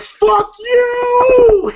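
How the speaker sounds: high, loud drawn-out yell; comic high-pitched shouted comeback; mock furious shout. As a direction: holler it back, playing outraged. Acted angry comeback while fleeing.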